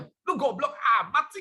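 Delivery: high, loud mock insult; imitating a harsh parent; mock scolding. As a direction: play a scolding parent, half-joking. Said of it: "nggak ada yang marah ya".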